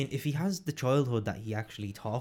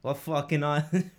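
put-on stretched-out, higher Brooklyn accent; 4 semitones higher, 6 dB louder than usual. Friend re-enacting the Brooklyn student's question, Brooklyn accent. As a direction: draw it out in broad Brooklyn. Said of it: "it's the way he does it".

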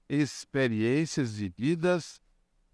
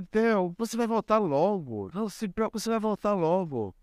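higher, gentle reassurance; soothing grandfather; 6 semitones higher than usual. Retold or imitated. Grandfather comforting his crying grandson, tender and higher.

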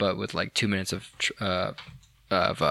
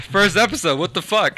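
incredulous; 9 semitones higher, 4 dB louder than usual. Real, not acted. Shocked, laughing at the show.

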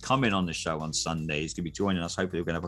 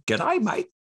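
put-on high, loud English accent gone Australian; mock an English accent that slides into Australian; botched English accent; 5 dB louder than usual. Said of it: "English accent always end up going".

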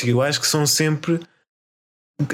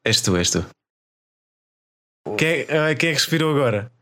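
ordinary talk

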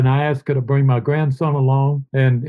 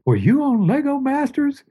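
mom's question; 12 semitones higher than usual. Retold or imitated. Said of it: "and his mom says".

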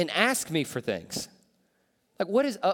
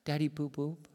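put-on softer, pleading toddler "Daddy boo boob"; puppy-eyed toddler plea; 8 dB quieter than usual. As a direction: beg like a puppy-eyed little kid. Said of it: "this little puppy eye thing".

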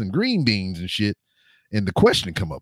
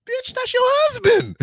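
high, slow incredulous squeal; exasperated squeal. Retold or imitated. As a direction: screech it in disbelief. Exasperated retort in retold scene.

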